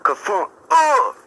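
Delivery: high, loud "ka-funk" and drawn-out "oh"; imitated crash. Homies scrambling over the fence, imitated crash and yelp. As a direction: mimic a thud and a high cry. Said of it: "you straight up heard".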